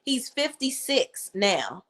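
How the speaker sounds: ordinary talk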